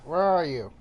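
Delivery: calling out. Real, not acted. Player calling out to enemy.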